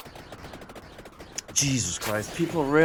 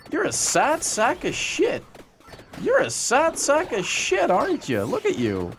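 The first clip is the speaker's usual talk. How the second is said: sing-song sneer at the troll; taunting; 8 semitones higher than usual. Acted. Streamer taunting a YouTube troll, high mock.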